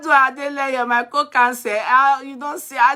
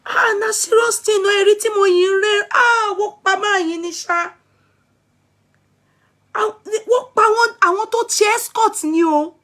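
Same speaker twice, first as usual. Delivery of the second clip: much higher, agitated alarm; real distressed exclaiming; 8 semitones higher than usual. Real, not acted. Streamer reacting to escorts being killed, distressed. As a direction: cry out in high-pitched dismay.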